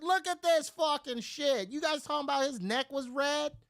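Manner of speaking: high-pitched, loud indignation; outraged protest. Retold or imitated. Man showing the cop his bite mark, outraged. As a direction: demand they look, voice shooting up.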